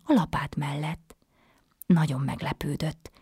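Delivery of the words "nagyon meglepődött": plain reading voice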